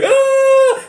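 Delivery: hammed-up slasher scream; imitated scream. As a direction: mimic a horror-film victim shrieking. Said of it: "and he's like".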